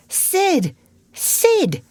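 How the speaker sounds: high urgent calls to wake a brother; calling Sid. Acted. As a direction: shout his name to rouse him. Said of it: "He said".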